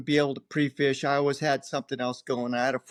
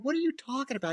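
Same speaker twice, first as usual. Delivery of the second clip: confused protest; 11 semitones higher, 4 dB quieter, faster than usual. Retold or imitated. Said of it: "I'm going".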